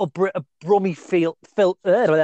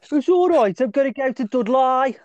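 raised-pitch mock Brummie; Brummie accent; 6 semitones higher than usual. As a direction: put on a high Birmingham caricature.

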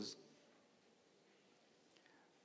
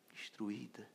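whispered; 12 dB quieter, slower than usual. Real, not acted. Describing his ruined pastor, in a whisper. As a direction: whisper it, broken.

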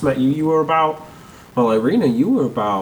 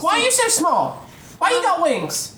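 put-on very high squeaky little boy; a nosy kid pestering; child voice. Altar boy voice.

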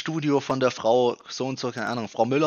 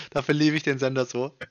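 ordinary talk, nothing marked

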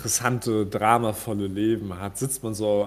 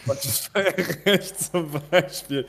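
laughing; 7 semitones higher, much slower than usual. Real, not acted. Repeats it, laughing.

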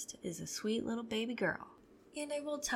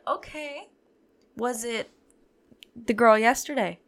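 questioning mom; 2 semitones higher, 12 dB louder than usual. Retold or imitated.

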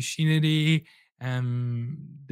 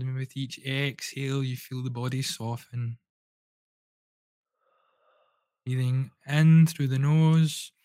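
guided breathwork. Acted. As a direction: lead the listener gently through each breath. Leads a guided breathing session.

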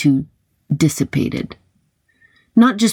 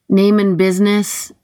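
lightly voiced robotic demand; a hint of a machine demanding; 4 semitones higher than usual. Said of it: "a robotic voice demanded".

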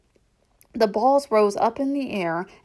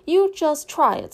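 put-on 1 defiant child; 8 semitones higher, 5 dB louder than usual. A narrator's reading. Gertie defiant.

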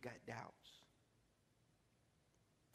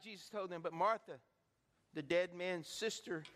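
ordinary talk, nothing marked

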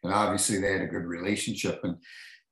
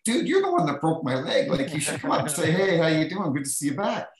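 mock indignant lecture; 8 semitones higher than usual. Mock scolding the teammate.

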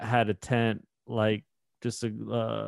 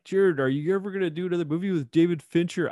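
teasing question; 8 semitones higher than usual. Retold or imitated. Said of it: "I said, I said".

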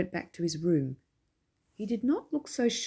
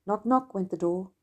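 ordinary delivery, nothing marked

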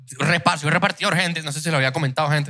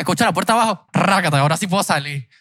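ordinary talk

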